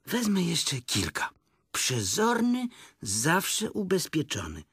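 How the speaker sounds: high, slow storybook piglet; thinking to oneself. A narrator's reading. Piglet gathering spare candles, high musing. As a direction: muse it slowly in a high voice. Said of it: "pomyślał sobie".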